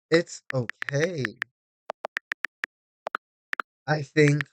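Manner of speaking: mock soothing. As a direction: talk them down, patronizingly gentle. Condescending calm advice.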